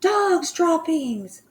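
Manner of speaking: slow, drawn-out high shout. Acted. The whole assembly shouts the dreaded cry, slow and high. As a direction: drag the cry out as a chant. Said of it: "they shouted".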